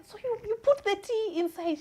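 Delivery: high, incredulous question; disbelieving. Retold or imitated. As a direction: sound appalled at the tea. Shocked granddaughter questioning grandma.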